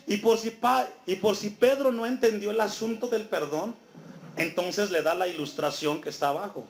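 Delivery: normal talking voice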